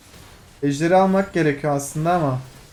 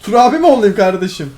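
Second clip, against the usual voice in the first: higher and louder, fed-up scolding; irritated rant; 5 semitones higher, 7 dB louder than usual. Real, not acted. Streamer ranting at an enemy player, irritated. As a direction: tell off the cocky enemy. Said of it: "Ayar oluyorum ya".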